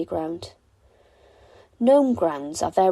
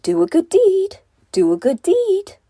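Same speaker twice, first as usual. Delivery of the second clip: very high, louder bird chirp; twittering echo; 11 semitones higher, 7 dB louder than usual. A narrator's reading. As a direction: chirp it like an echoing bird call. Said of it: "with a gentle twitter echo".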